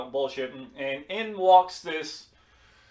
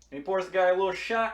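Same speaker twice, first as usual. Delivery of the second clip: usual speaking voice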